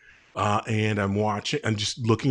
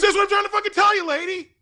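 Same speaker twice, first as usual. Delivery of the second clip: very high, fast, hammed-up yell; exasperated outburst; 3 dB louder, faster than usual. Produce guy finally loses it at the lady, exasperated yell. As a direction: blow up in comic exasperation. Clerk blows up at joke's punchline.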